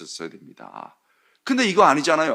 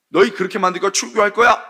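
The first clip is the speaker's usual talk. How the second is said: threatening; 6 semitones higher, 6 dB louder than usual. Retold or imitated. Leaders threatening with expulsion.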